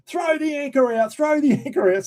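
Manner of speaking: very high, repeated cry of alarm; mock urgent yell. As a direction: imitate a mate calling out desperately. Mate's urgent order retold.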